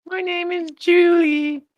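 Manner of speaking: put-on high, sweet little girl voice. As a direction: play an eager schoolgirl asking a favour. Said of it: "make myself look like a little girl".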